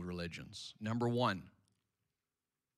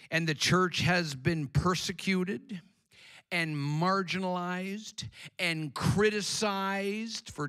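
rising, louder preaching build; 7 semitones higher, 5 dB louder, slower than usual. Acted. Preacher exalting the church to the congregation, full preaching. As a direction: preach it higher and stronger.

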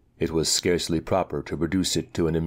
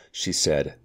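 ordinary delivery

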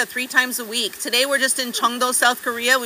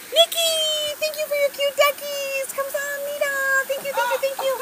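squeaky high thank-you voice; bright little cutesy voice; 12 semitones higher, 4 dB quieter than usual. Acted. High cute voice thanking donors.

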